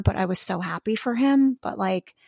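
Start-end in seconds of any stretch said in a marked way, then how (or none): none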